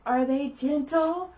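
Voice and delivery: put-on high, slow child voice; drawn-out, high little-girl question. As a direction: ask it innocently like a small girl. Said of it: "the little girl goes".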